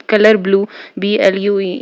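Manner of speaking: ordinary delivery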